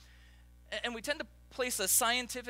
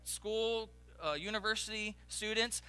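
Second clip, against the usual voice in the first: usual speaking voice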